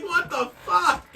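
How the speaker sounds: high, slow bewildered wail; mock bewildered cry. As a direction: act out his confusion. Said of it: "it's like".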